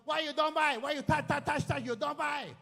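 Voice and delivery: furious Hong Kong shop owner shouting; angry shop owner. Retold or imitated. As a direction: yell at the customer who touched everything. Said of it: "kalau you tak beli and you walk out".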